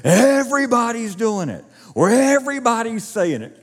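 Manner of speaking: high, loud mocking of a crowd claim; mock gossip. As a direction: parody the bandwagon voice. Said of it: "anytime somebody says".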